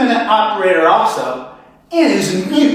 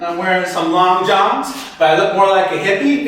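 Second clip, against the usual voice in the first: ordinary talk, nothing marked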